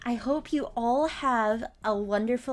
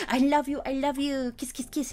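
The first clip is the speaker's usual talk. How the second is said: higher, sweet loving cat voice; cat Stitch voiced; 6 semitones higher, 3 dB louder than usual. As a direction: gush like a cat who got his cuddles. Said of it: "he'd be like".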